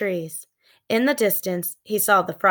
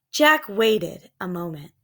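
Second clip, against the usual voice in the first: ordinary delivery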